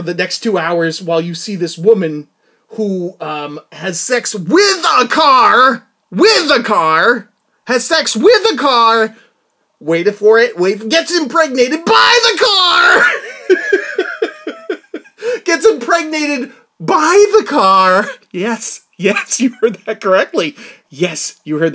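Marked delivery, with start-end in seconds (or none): 3.7-9.2 slow, loud, stretched phrase with a car; drawn-out emphasis. Real, not acted. Vlogger stressing the absurd plot point, slow and loud. 10.6-18.1 high, loud, slow shout cracking into laughter; laughing shout. Real, not acted. Vlogger shouting the absurd twist while laughing.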